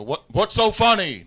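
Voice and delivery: loud, high, drawn-out angry demand; retold furious challenge, voice pushed up. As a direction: shout it like an irate superior. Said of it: "All angry".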